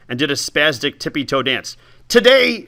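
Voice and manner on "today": high, loud squeak. Acted. Old woman announcing the experiment, high squeak. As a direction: squeak it through the pain. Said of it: "she squeaked".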